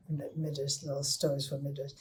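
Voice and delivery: plain reading voice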